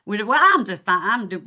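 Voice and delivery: imitated Kentucky, wide vowel on "fine"; Kentucky accent. Coach contrasting it with Texas, Kentucky accent. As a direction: break into Kentucky talk. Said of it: "very different to the Kentucky accent".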